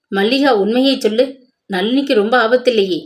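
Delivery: usual speaking voice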